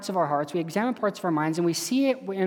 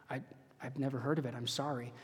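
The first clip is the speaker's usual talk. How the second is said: sheepish thief; 4 semitones lower, 12 dB quieter than usual. Retold or imitated. Thief apologizes to supervisor angel.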